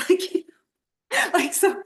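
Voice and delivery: real laugh breaking in, high. Real, not acted. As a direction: laugh through the word. Laughing at funny answer.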